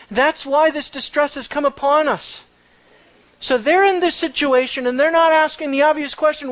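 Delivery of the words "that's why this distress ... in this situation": ordinary talk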